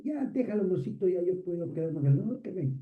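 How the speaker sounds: high, fast little voice of a loving wife; sweet wife voice. Retold or imitated. Wife answering her husband, sweetly dismissive. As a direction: play the wife, sweetly brushing it off.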